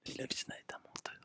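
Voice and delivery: breathy whisper, fast. Pretend. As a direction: whisper it quickly. Whispered aside about Noé.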